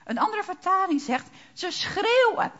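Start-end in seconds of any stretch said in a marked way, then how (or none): 1.5-2.5 cry out. Acted. Quoting the people's cry.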